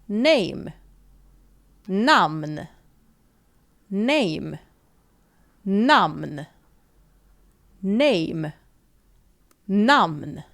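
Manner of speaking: Name with ay, namn with an open a and a hummed n; alternating namn and Name. Shown as a demonstration. Teacher drilling the Swedish and English pair. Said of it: "we pronounce it namn".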